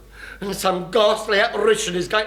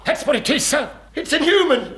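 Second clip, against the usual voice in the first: high-pitched protest; 6 semitones higher than usual. Acted. Chief protests in high alarm.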